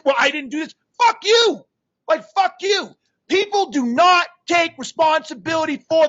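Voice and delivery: real angry rant, high and loud; exasperated, shouted tirade; fired up. Real, not acted. Coach ranting about people who won't read his book, fired up. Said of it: "I got fired up".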